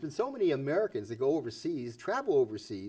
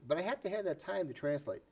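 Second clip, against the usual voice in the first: ordinary talk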